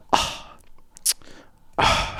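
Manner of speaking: breathy whispered "ah, ah" of unease; whispered nervous gasps. Retold or imitated. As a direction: cringe away from the chair. Nervous neighbour in elevator.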